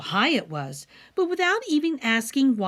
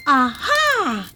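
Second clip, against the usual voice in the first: a bright, louder "aha" of discovery; the queen hits on her plan, a little higher; exclaiming; 5 semitones higher, 5 dB louder than usual. A narrator's reading. Queen struck by a clever idea, exclaiming aloud. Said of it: "the queen exclaimed".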